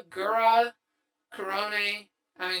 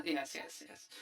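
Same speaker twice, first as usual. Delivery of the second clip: whispering; 5 semitones lower, 14 dB quieter than usual. Real, not acted. Reacting to the sus emotes.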